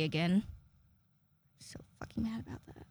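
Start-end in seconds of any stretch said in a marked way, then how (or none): 1.6-2.9 hushed, much softer grumble; whispered aside. Real, not acted. Fan grumbling about being called Natalie, whispered. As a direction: drop to a whisper.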